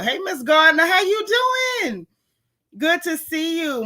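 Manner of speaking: high, loud holler hello; excited greeting. Real, not acted. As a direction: call out high to a friend who just arrived. Calling out greeting to arriving viewer.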